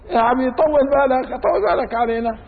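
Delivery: high-pitched plea for patience; impatient plea. Acted. Follower asking the imam to be patient, high impatient plea. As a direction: plead up high, asking him to hold on.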